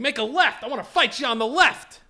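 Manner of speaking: high, loud retold challenge; mock challenging driver. Imitating driver demanding a fight, high and loud. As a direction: mimic the driver calling from his car. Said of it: "now he's still doing this".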